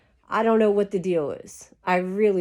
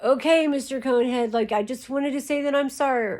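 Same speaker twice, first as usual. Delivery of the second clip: higher-pitched mock apology to a girlfriend's dad; placating apology in a raised, put-on voice; Ronnie; 5 semitones higher than usual. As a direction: imitate a pleading boyfriend, pitched up. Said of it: "you know, Ronnie".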